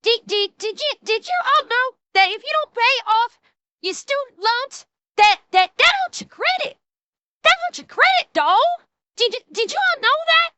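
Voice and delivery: high-pitched mock Southern accent. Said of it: "I'm doing a Southern accent".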